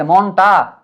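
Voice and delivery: মনটা stretched slowly over both syllables; word held up for notice. Shown as a demonstration. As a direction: draw out মনটা so it stands out. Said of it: "ওয়ার্ডটা ভালো করে খেয়াল করো". (মনটা টেনে টেনে, ধীরে)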